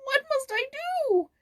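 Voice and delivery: put-on a boy about to burst into tears; tearful boy's confession, pitched high; close to tears. A narrator's reading. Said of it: "ready to cry".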